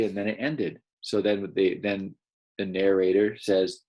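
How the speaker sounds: ordinary delivery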